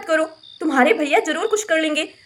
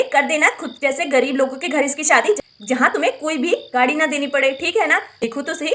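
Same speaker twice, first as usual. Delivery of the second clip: normal talking voice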